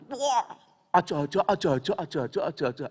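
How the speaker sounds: loud, excited Javanese Tegal "aja, aja" shouted at a sick man; shouted Tegal warning. Retold or imitated. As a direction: Tegal dialect mock alarm, shouting "aja". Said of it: "Ya tahu orang Tegal bilang".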